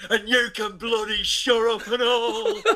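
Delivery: high, loud mock Burton screech; Burton accent. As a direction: snap at the laughing lad in her accent. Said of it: "she turned round and went".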